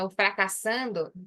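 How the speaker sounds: ordinary talk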